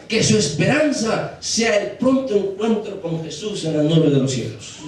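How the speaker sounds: impassioned sermon, slowing towards the end; fervent preaching. Real, not acted. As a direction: drive each phrase home, then draw it out. Preaches at full force.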